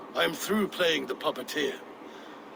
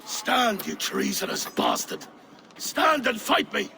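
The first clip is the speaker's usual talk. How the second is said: loud, higher battle challenge; furious challenge; 6 semitones higher, 3 dB louder than usual. Acted. King challenging the traitor to fight, furious shout. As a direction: roar him to his feet.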